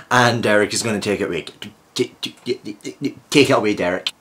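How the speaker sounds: usual speaking voice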